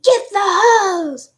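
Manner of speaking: loud, high, drawn-out retold scream; high, slow yell of command; mom screaming. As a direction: imitate a panicked order yelled across a yard. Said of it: "my mom's screaming at me".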